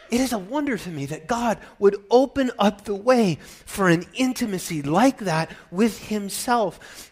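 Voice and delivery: ordinary talk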